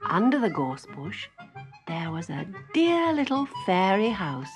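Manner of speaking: ordinary delivery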